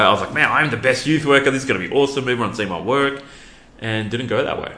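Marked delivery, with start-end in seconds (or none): 0.3-3.2 loud, higher mock boast; big, overconfident retold brag; cocky self-hype. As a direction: hype yourself up as the best. Retelling his earlier cocky self-talk.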